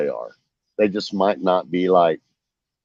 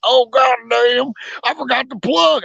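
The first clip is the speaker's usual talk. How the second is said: much higher, slow mock old-man voice; buddy's voice; 14 semitones higher than usual. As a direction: stretch it out high like the old buddy who forgot the plug. Buddy voiced in boat story.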